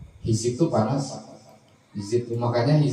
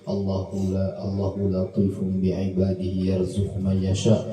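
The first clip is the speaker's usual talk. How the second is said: recited prayer; 4 semitones lower, slower than usual. Acted. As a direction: intone the dua slowly and devoutly. Recites closing supplication.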